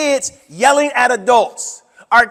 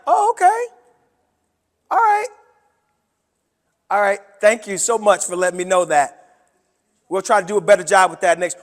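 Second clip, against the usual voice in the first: composed polite reply; 3 semitones lower, 3 dB quieter than usual. Acted. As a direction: keep your cool with an angry parent. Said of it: "Or I could be like".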